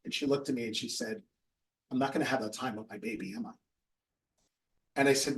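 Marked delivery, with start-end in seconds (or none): 1.9-3.5 low, hushed retold heartbreak; quietly crushed, voice dropped low and soft; tearful realization. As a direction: imitate someone softly close to tears. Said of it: "Her eyes filled up with tears".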